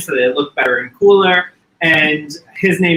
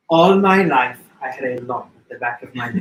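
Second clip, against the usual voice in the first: Aunt Voula quote. Retold or imitated. Quoting the aunt about the lump, mild Greek accent. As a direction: confide it with a light Greek accent. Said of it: "my other favorite line is".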